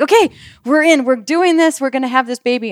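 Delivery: high, eager reenacted rallying; rally everyone, retelling the excitement; excited. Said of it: "I got all excited".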